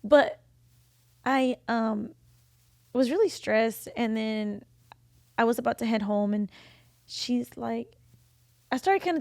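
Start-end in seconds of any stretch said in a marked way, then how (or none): none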